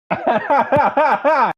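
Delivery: sharp high burst of laughter; high mocking laugh. Real, not acted. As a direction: laugh it off in disbelief. Mocking a silly claim.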